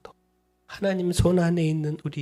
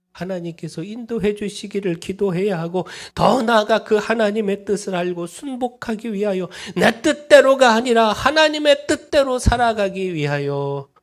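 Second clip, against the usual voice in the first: preaching that climbs higher and louder; rising exhortation, ending much higher and louder; building sermon; 4 semitones higher, 6 dB louder than usual. Acted. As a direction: swell toward a ringing peak. Preacher rises again.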